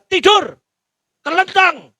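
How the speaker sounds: high, loud command to lie flat; master's loud order. Acted. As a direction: bark it as a demand. (perintah keras majikan)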